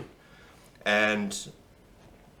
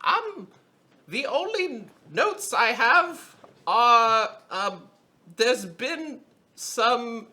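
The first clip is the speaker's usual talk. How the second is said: much higher, slow, timid clerk voice; Bushel reporting; 13 semitones higher, slower than usual. Acted. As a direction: nervously read the notes to the council. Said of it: "Bushel then goes".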